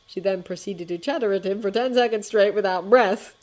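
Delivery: ordinary delivery, nothing marked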